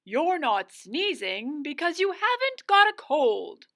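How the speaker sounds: a hint of a wise old wizard answering from behind. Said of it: "a voice behind him replied".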